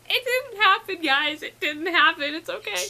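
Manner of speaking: high, squeaky self-consolation; high-pitched, nearly tearful lament; mock-tearful consoling. Real, not acted. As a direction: squeak it out, wounded but coping. Consoling herself over missed eye contact.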